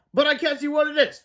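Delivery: high-pitched confusion; acted bewildered alarm; alarmed cry. A narrator's reading. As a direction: exclaim about an unseen grabber. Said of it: "cried Cheeseball".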